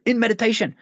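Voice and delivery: in meditation said high and pointed; single out "in meditation." as the key phrase; stress on in meditation. Shown as a demonstration. Sponsor pointing his sponsee to the key phrase, stressed. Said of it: "The next word".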